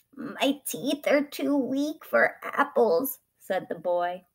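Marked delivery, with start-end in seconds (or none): 0.1-3.2 put-on slow, high, feeble old voice; old man. A narrator's reading. Old boy refusing the tree's apples, slow and aged. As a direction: draw it out like a frail old man. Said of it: "So skinny and old now".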